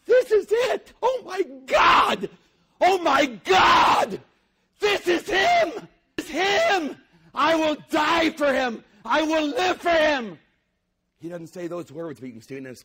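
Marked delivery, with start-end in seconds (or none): 0.1-10.4 very high, loud, slow overjoyed cries; ecstatic amazement. Acted. Preacher voicing Peter's thrilled inner cry at Lazarus. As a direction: lose yourself in astonished joy. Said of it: "he says to himself".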